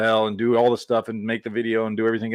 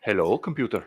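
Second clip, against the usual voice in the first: put-on Scottish accent from a sci-fi film; Scotty. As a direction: imitate a Scottish engineer addressing a machine. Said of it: "I sound like Scotty from".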